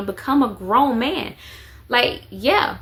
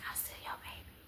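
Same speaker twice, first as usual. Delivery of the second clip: imitating a son's secret whisper; a little boy's whispered claim, imitated; 26 dB quieter, much faster than usual. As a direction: breathe it like a secret in mom's ear. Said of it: "he'll come and whisper it in my ear".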